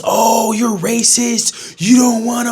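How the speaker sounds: high, drawn-out mock accusation; mock outrage. Critics reacting to his dating preference, mock outrage. Mocking critics calling him racist.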